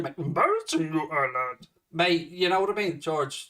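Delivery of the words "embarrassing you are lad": put-on Star Wars creature voice; mock Star Wars character. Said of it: "you're fucking from Star Wars here".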